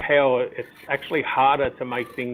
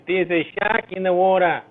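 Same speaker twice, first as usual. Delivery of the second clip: mock Aussie accent; 4 semitones higher than usual. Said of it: "the only thing they know how to say is".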